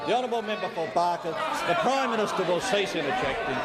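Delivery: ordinary delivery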